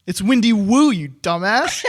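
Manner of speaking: loud, high, teasing yell; shouted punchline. Pretend. Knock-knock joke punchline.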